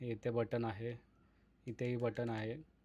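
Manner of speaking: ordinary talk, nothing marked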